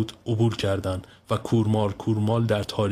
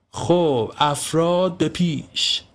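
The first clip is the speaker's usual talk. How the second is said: slow, higher, pompous troop-leader call; mock commander; 7 semitones higher, much slower than usual. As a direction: play the general ordering his troops. Self-styled leader commands friends.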